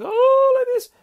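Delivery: shrieking high and loud in mock fright; scared girl. Girl watching the mouse on the stairs, hammed-up shriek. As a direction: squeal like a terrified child. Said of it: "She's going".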